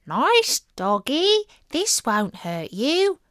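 high, slow fake-kind coaxing; weaselly sweet talk. A narrator's reading. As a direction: coax the dog sweetly. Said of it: "Kind, weaselly words like".

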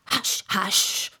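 whispered urgent hush; low, hurried. A narrator's reading. Rabbit hushes Alice in a low, hurried tone. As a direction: shush in an unvoiced whisper. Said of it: "in a low, hurried tone".

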